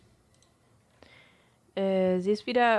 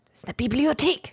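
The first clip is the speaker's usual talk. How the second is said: stern librarian; 8 semitones higher than usual. Acted.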